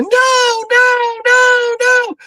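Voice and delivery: very high, wailing mock panic; mock panicked wailing. Host mocks the girl trapped with the killer, high panicked wail. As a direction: squeal it like a helpless horror victim. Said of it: "and she just going".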